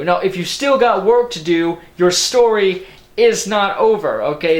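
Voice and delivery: fired-up complaint to the viewers; exasperated rant. Real, not acted. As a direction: push back at the film, voice rising. Ranting at the movie's contradiction.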